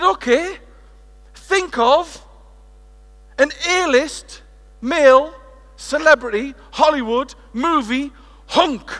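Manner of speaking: loud, high build-up, stretching the words slowly; hyped-up. Acted. Speaker to the conference woman, building loud and slow excitement. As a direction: sell the movie hunk to the room.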